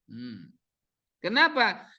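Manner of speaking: ordinary talk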